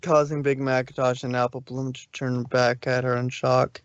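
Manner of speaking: usual speaking voice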